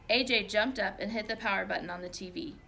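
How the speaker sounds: usual speaking voice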